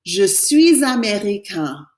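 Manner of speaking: "Je suis américain" slowly, nasal end with no N; teacher modelling the boy's version; slow French model. Shown as a demonstration. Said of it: "I'm going to say".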